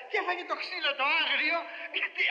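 ordinary talk

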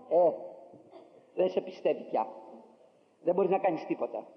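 ordinary talk

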